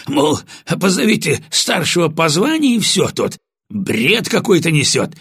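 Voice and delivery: high, agitated, slightly winded report; slightly breathless. A narrator's reading. Sergeant justifying himself to the officer, slightly breathless. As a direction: complain excitedly, a little out of breath. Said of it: "слегка запыхавшимся голосом".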